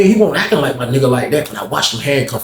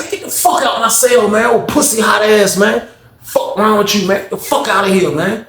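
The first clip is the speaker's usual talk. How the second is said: mock angry shout; 7 semitones higher than usual. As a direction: throw him out of the cell. Narrator throws George out.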